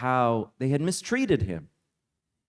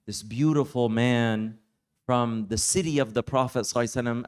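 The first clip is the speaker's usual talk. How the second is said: usual speaking voice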